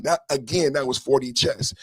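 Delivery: normal talking voice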